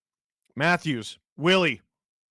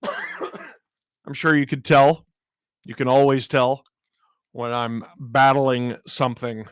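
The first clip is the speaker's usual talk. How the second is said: sick voice; 3 semitones lower, 7 dB louder, slower than usual. Real, not acted. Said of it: "I got this deep, sexy voice going on".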